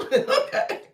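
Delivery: high, loud laugh into okay. Real, not acted. Laughing at a viewer's comment.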